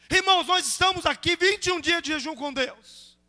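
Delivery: loud, high, fired-up sermon delivery; fervent, shouted pulpit exhortation; full-force preaching. Real, not acted. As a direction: hammer it out to the congregation.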